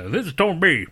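half-hearted Cajun try; mock Cajun accent. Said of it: "talk all Cajun".

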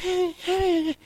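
high squealing laugh impression; mock high laugh of the neighbor. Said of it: "I hear, like".

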